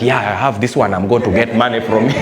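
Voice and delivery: low, sly gloat; low chuckling rider. Retold or imitated.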